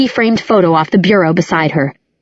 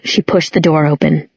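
plain reading voice

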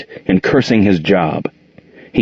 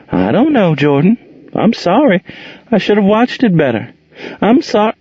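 apologetic; 12 semitones higher than usual. A narrator's reading. Monique apologising, confused.

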